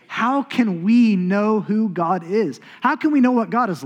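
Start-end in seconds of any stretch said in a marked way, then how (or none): none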